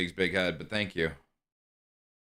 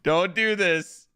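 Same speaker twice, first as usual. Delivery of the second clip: hammed-up dramatic plea; 10 semitones higher, slower than usual. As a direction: overact the plea like a soap star.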